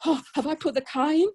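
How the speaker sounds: put-on quick, high, flustered old man woken at night; flustered husband. Husband woken in the night, flustered about the car. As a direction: fret about the car in a rush. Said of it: "You know, all flustered".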